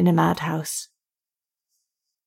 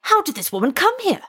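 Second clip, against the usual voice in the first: much higher, startled demand; alarmed question; 11 semitones higher than usual. Acted. Officer demanding how the dead woman got there, high startled. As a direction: blurt it out in disbelief.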